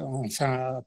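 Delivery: ordinary talk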